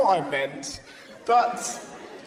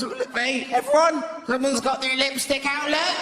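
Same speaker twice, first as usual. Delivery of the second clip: imitating mum; 5 semitones higher than usual. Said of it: "My mum's".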